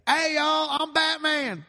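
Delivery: high, loud Southern twang; [name] voice. Pretend. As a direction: bubbly pop star drawl. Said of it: "[name]".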